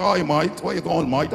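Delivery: exaggerated working-class English "mate"; parodied English street greeting accent; mock English accent. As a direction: mimic the locals' English accent. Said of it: "me can't hear good English".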